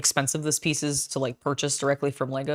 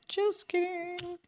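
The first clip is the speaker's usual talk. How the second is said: much higher, slow sing-song; high playful voice; 14 semitones higher, 6 dB quieter, slower than usual. Pretend. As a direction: jump way up in pitch, teasing. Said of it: "oop, I did that wrong".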